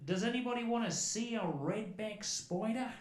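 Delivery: high, slow Australian singsong; cool wee Australian voice. Retold or imitated. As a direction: casually call out an offer, Australian accent. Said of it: "with his cool wee voice".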